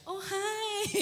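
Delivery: high, wispy flirty hello; a simpering falsetto hi; mincing greeting. Shown as a demonstration. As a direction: squeak it out all limp and dainty. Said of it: "itu bukan lemah lembut ya".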